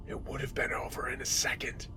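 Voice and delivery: soft, creepy whispered threat; fully whispered, hushed menace; menacing whisper. Acted. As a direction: whisper it as a chilling parting threat. Intruder's parting threat, whispered.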